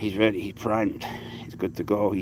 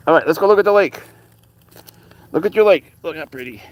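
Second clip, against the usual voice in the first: pet talk; 6 semitones higher, 7 dB louder than usual. Real, not acted. Urging cat toward lake.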